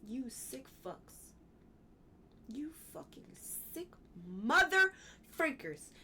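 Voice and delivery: seething under the breath, then a burst on mother; worked up. Real, not acted. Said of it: "Sorry, I'm getting worked up".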